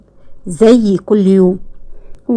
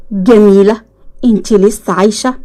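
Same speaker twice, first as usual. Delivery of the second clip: usual speaking voice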